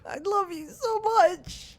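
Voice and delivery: high, slow adoring coo; gushing love. Real, not acted. Streamer cooing love at the game's character. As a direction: swoon over the character lovingly.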